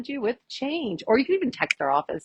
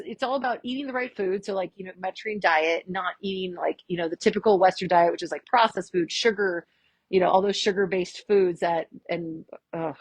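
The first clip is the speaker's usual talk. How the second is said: ordinary delivery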